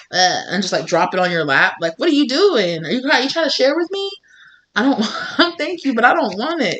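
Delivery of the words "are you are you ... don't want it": pitched up, puzzled; high baffled voice. Real, not acted. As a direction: talk to the dog, bemused and high. Talking to her dog.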